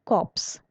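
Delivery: p and s sounded in corps; 'corps' said as 'corpse'. Shown as a demonstration. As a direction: say corps with a final 'ps'. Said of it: "इस word को pronounce करते हैं".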